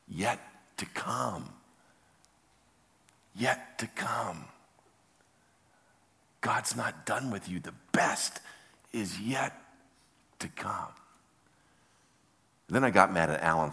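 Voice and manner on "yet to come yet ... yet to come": hushed, slow, spaced-out phrases; soft inner whisper. Retold or imitated. God's whisper nagging Don all day, hushed insistence. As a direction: let each phrase land softly. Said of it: "And that whisper".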